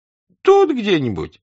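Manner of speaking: high, insistent servant voice; the servant insisting nobody else was in the room, high and plaintive; servant Lavrushka. Acted. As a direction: plead it up high. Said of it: "сказал Лаврушка".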